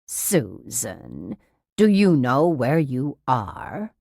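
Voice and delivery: patronizing, measured sing-song care; talking to small child. A narrator's reading. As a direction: speak as if to a toddler. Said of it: "tone of voice usually reserved for very young children".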